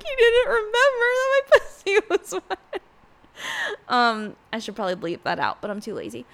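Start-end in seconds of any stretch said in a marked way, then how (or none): 0.0-2.8 very high, loud hammed-up wail; mock crying. Vlogger re-enacting what the contestant cried about, mock wailing. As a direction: wail it mockingly, breaking into a laugh. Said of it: "The fact she was crying about that".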